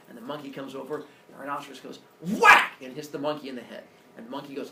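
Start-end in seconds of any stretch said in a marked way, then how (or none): 2.2-2.7 big high smack noise; loud whack. Retold or imitated. Teacher acting the rhino's rock hit, loud and high. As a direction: belt out the hit sound.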